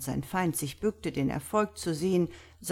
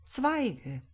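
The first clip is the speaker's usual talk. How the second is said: musing, higher-pitched single word; thoughtful; 5 semitones higher than usual. A narrator's reading. Priest answering the doctor's puzzle, thoughtful. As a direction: say it pensively, drawn from thought. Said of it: "erwiderte der Priester nachdenklich".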